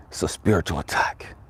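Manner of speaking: low, hushed whisper; retold inner answer, nearly voiceless; whispered revelation. Voices the answer heard from God.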